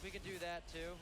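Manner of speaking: normal talking voice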